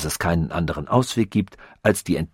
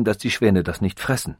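ordinary delivery

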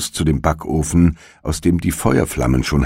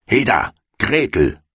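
higher, impatient witch call; 6 semitones higher than usual. A narrator's reading. Impatient witch calling the girl to work. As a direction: snap her name across the room like an old witch. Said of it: "rief sie dem Mädchen zu".

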